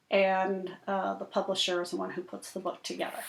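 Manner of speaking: plain reading voice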